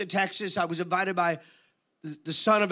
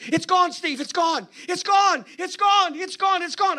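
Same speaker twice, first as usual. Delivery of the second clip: high, loud repeated wail of loss; distraught despair; 9 semitones higher, 6 dB louder than usual. Retold or imitated. Pastor pacing and telling Steve his anointing is gone, distraught. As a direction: cry it out over and over, desperate.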